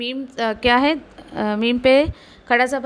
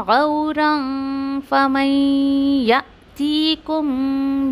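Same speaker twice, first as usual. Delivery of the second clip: slow melodic tajweed recitation; chanted Quranic verse; much slower than usual. Shown as a demonstration. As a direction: recite slowly, drawing out each sound.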